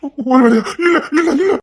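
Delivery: high, loud, tearful no no no; distraught denial. Acted. Husband refusing the warning about his wife, crying in denial. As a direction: beg it not to be true.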